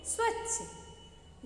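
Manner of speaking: स्वच्छ stretched slowly with a clear "स्व" cluster; teacher modelling स्वच्छ for children to repeat; slow correct pronunciation. Shown as a demonstration. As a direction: draw स्वच्छ out slowly and carefully. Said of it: "क्या बोलते हैं?".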